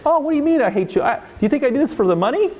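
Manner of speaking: high, fast indignant mock reply; defensive teacher. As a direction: scoff like an offended teacher.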